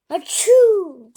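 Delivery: big high acted sneeze; loud sneeze. Gerald sneezing all over Piggy, loud fake sneeze. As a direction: let a huge sneeze burst out.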